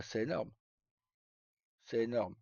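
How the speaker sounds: ordinary talk, nothing marked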